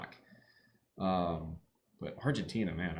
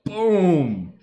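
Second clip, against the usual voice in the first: excited shout; 8 semitones higher, 10 dB louder than usual. Real, not acted. Celebrating big play live.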